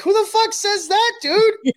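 high-pitched, loud mock disbelief; incredulous. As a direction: imitate him squawking in baffled amusement. Acting out lieutenant's disbelief at slang.

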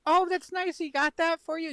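mom voice. Retold or imitated. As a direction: play the delighted mom, way up high. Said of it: "she's like".

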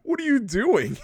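high-pitched exasperated question; mock outrage, pitched right up; incredulous scolding. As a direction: demand it in exaggerated disbelief. Helen scolding in retold scene.

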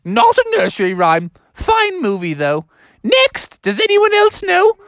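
high, dismissive teacher goose; Mother Goose. Acted. Teacher rejects the mouse's answer, high indignant goose. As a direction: scold in a high falsetto. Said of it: "She's like".

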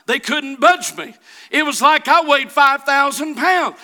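high, loud testimony building in intensity; fervent preaching. Real, not acted. Preacher telling congregation six linemen couldn't move him, mounting fervor. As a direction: drive the story home, rising to a shout.

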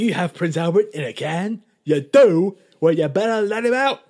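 high, loud, gleeful prank call voice; cackling prankster setting up the joke; hammed-up prank caller.

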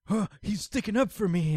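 mock gasp, then high-pitched delight; touched surprise. Sanda reacting to being defended, touched surprise. Acted quote of touched character.

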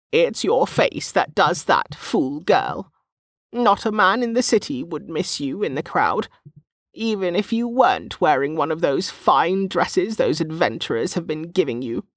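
put-on high-pitched, teasing old market woman; snorting old woman. A narrator's reading. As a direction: scold her fondly in a high old-woman voice. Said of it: "the old woman snorted".

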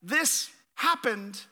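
"this happened" said slowly and deliberately; stressed phrase. Shown as a demonstration. Pastor pointing out added words to the congregation, slow and deliberate. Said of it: "what I want to point out".

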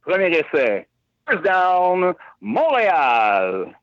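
stadium announcer calling the down. Shown as a demonstration. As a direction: boom it out like a stadium PA. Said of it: "in your best P A voice, say".

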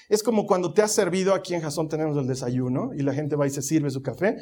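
ordinary talk

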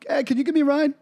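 innocent, high little request; casual request. Retold or imitated. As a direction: ask a favor breezily. Retold casual request for a lift.

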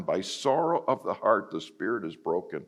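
solemn scripture repeat. Acted.

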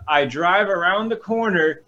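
ordinary talk

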